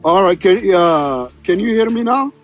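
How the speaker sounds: usual speaking voice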